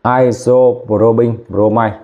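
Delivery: "Isoprobin bromide" drawn out slowly, each syllable clear; slow careful model. Shown as a demonstration. Teacher to students, modelling the compound name slowly. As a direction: model it slowly for the class to copy. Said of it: "C H ba này đọc là iso".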